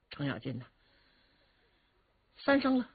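ordinary delivery, nothing marked

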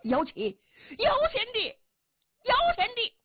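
rising high calls of the name; anxious calling. Acted. Jia Fu outside calling anxiously for his sworn brother. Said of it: "贾父在外边着急".

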